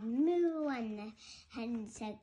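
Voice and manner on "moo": loud, drawn-out, higher moo; cow's moo. A narrator's reading. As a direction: moo out loud like a storybook cow. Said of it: "And the cow said".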